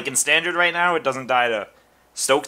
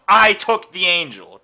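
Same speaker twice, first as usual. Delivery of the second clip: loud, slow, raised-pitch proclamation; mock scream; 5 semitones higher, 10 dB louder than usual. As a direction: announce it to the whole table at full volume. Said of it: "by screaming at them".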